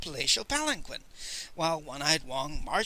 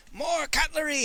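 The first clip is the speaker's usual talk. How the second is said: high, stretched excited call; howled command; 11 semitones higher than usual. A narrator's reading. Miser demanding more blades to sharpen, howling. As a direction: howl it out high to those nearby. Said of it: "howled Miser Shen".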